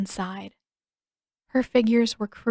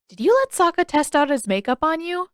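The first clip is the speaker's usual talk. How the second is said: put-on much higher, fast, bubbling boy's voice; excited young Aang; 8 semitones higher, 6 dB louder than usual. Aang gushing to Katara about the koi, bubbly high boy. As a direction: tumble the words out, thrilled.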